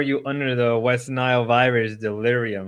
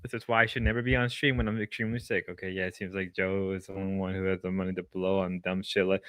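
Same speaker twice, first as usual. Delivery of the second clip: ordinary delivery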